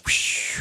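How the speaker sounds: soft, breath-only whoosh sound effect; whispered whoosh. Retold or imitated. Storyteller spotting cop lights, whispered whoosh. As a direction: blow out a quiet "whoosh".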